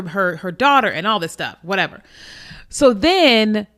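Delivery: normal talking voice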